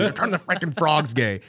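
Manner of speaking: loud, high ranting impression; Alex Jones impression. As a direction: rant it like a conspiracy host. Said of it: "He's like".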